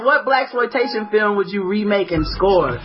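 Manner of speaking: ordinary delivery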